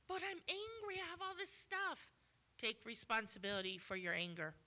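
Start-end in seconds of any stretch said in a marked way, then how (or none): 0.1-2.1 much higher, whiny objection; mock angry protest. Preacher mimics a member pushing back, high whiny protest. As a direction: squeal the excuse like a sulky child.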